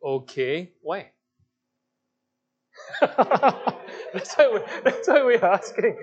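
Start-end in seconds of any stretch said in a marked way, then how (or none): 3.0-6.0 chuckling through the words, higher and louder; laughing. Real, not acted. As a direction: laugh while you coax. Teacher laughing at quiet class.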